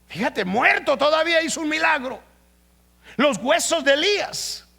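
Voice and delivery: loud, high, driving sermon voice; fiery preaching. Acted. Pastor thrilling the church with Elisha's miracle, fiery preaching. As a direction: build the miracle story with fire.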